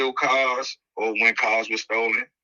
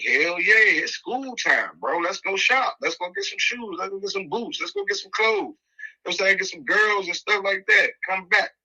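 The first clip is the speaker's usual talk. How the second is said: higher, excited rattling off of plans; eager reply; 6 semitones higher than usual. Retold or imitated. Answering his friend's invite to the mall, eager and higher. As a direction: hype it up like a kid ready to shop. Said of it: "I'm like".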